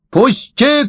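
put-on narrated yell of an insisting old man; old man's cry. A narrator's reading. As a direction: cry out high, demanding to be let through. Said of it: "кричал старик".

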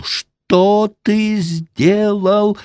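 high, very slow drawn-out scream; screaming question. A narrator's reading. Wife screams at the lout over the disaster, high and drawn out. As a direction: wail it out in horror. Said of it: "кричала Лава".

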